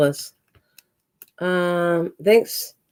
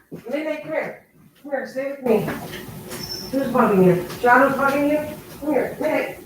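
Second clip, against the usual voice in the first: high, soft coaxing call to a pet; calling a cat; 5 semitones higher, 5 dB quieter than usual. Real, not acted. Streamer going off to save the cat, calling it in a high coaxing voice. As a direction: coax the cat over in a higher voice.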